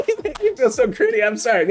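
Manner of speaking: high, helpless laughing; real laughter. Real, not acted. Streamer cracks up at their own slip, laughing. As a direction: laugh through the words.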